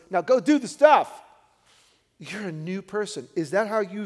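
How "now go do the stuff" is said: rousing command. Acted. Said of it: "he's saying it to every one of us".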